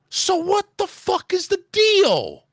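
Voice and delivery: slow, high-pitched angry demand; exasperated outburst. Retold or imitated. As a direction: drag out the furious question, high. Retold row with the supervisor.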